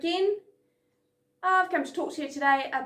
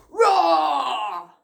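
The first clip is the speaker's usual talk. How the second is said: loud stern roar; giant roar; 7 dB louder than usual. Shown as a demonstration. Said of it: "you are going to be either a giant".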